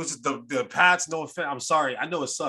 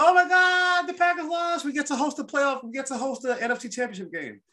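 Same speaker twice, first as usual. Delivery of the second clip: high, thrilled gush; mock-thrilled fan squeal; excited fan; 10 semitones higher than usual. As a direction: gush like a fan whose team just got a gift. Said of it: "I'm like".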